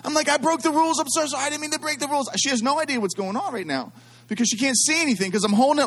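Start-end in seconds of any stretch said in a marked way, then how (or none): 0.3-2.3 high-pitched mock-frantic confession; panicked child. Child frantically apologizing to mom.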